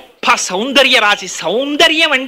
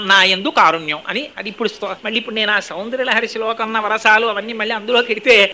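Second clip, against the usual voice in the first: ordinary talk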